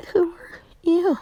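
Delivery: high, airy, hesitant question; high hushed wonder; timid questioning. Acted. Kid meets caged creature.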